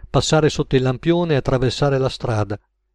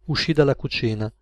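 usual speaking voice

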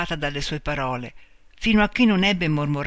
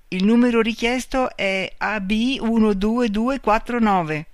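lightly voiced telephone operator, higher pitched; operator's voice; 6 semitones higher, 4 dB louder than usual. A narrator's reading. Operator tells Marta the requested number, lightly voiced official announcement. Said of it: "Una voce le disse".

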